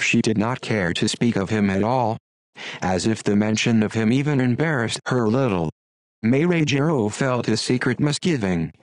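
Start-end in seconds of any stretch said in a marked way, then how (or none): none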